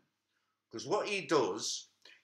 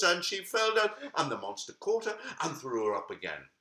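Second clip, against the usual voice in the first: ordinary delivery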